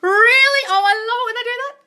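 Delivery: high, loud delighted gasp of surprise; light up with amazed delight; thrilled surprise. Real, not acted. Delighted by viewer's news.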